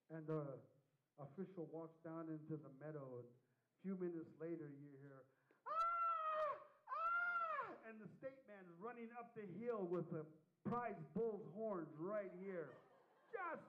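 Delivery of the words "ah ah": two high shrieking screams of panic; screams of terror. Retold or imitated. Official fleeing a bull, high terrified screams.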